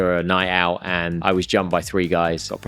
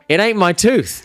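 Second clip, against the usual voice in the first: retold happy relief; 10 semitones higher, 4 dB louder than usual. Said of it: "smiled, and was like".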